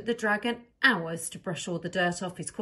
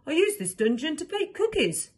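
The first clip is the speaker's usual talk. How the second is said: kind villain; 8 semitones higher, 4 dB louder than usual. Acted.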